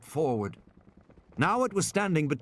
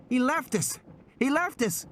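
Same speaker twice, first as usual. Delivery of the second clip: put-on screaming child; 13 semitones higher than usual. Lex wails that Ed Regis abandoned them, screaming child. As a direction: wail it out like a terrified girl. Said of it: "she had started to scream".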